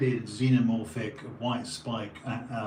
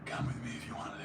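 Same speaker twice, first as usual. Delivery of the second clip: hushed, voiceless gravelly rasp; Kyle Reese impression; 8 dB quieter, much faster than usual. Streamer going full Kyle Reese on a film line, hushed rasp. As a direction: drop to a rough stage whisper. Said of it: "full Kyle Reese".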